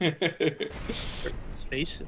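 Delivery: slow words through laughter; laughing. Real, not acted. Laughing while saying fake Swedish.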